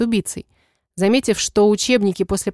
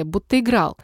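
ordinary delivery, nothing marked

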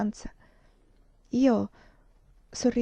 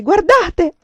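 high, loud frantic shout; screamed alarm; 9 semitones higher, 11 dB louder than usual. A narrator's reading. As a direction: yell it out in alarm.

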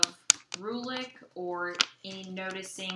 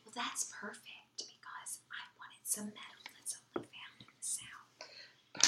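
whispered aside; 2 semitones higher, 7 dB quieter than usual. Acted. Noblewoman whispering her secret wish to the broker. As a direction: lean in and whisper so the merchants can't hear. Secret deal at a market stall.

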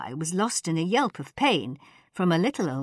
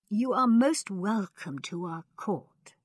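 slow, high, thin courtly welcome; gracious old ruler; 5 semitones higher, slower than usual. A narrator's reading. Khan welcoming ladies to court, gracious old voice. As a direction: welcome them grandly in a thin voice.

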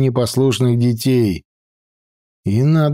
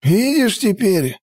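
put-on old mage voice; 8 semitones higher than usual. A narrator's reading.

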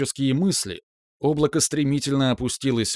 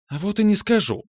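high, teasing sing-song; snide teasing; 8 semitones higher than usual. Acted. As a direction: tease him, smugly withholding. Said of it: "съехидничала скандалистка". (ехидно, с издёвкой)

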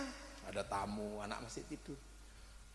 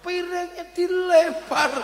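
exasperated; 10 semitones higher, 11 dB louder, slower than usual. Retold or imitated. Child throws plate.

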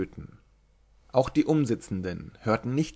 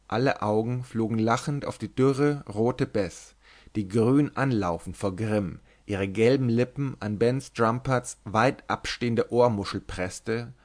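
ordinary delivery, nothing marked

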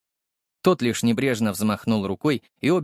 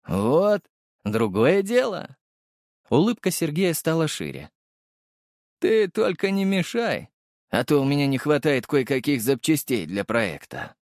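creepy villain; 4 semitones higher than usual. A narrator's reading.

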